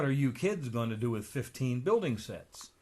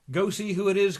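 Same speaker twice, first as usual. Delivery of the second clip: higher and louder call; 7 semitones higher, 8 dB louder than usual. Acted. Donald calls to the kids to get the door, calling out. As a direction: call out across the house.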